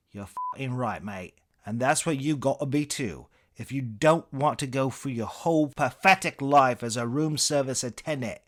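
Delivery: contemptuous English comedian, building from level to loud; Eric Idle. Acted. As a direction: drive it home in an angry British accent. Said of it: "impression of Idle's Cambridge-tinged inflections".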